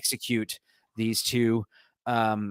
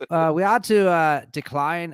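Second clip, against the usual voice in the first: put-on louder Northern English accent sliding into Irish; Irish accent by mistake; 3 semitones higher, 5 dB louder than usual. Podcaster reading a headline in a slipping Irish accent. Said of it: "I keep doing Irish".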